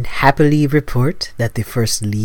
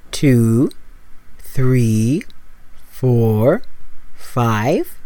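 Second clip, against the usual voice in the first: slow breath counting; 3 dB quieter, much slower than usual. Acted.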